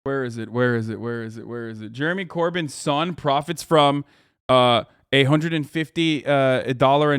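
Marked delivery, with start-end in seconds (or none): 0.1-1.9 low, quiet repeated question; muttering to oneself. Real, not acted. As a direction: mumble it while hunting for something, fading. Searching for an article, to himself.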